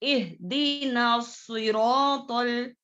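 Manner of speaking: slow इहदीना सिरातुल with a thick ta; heavy ta. Shown as a demonstration. Teacher repeating the verse for pupils with a heavy ta. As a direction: draw out सिरातुल, the ta said full and heavy. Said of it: "मोटा पढ़ेंगे".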